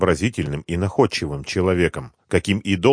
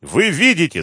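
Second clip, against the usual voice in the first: high, loud appeal to witnesses; крик; 10 semitones higher, 5 dB louder than usual. A narrator's reading. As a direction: cry out high for others to see. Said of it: "закричал депутат". (высокий возмущённый крик)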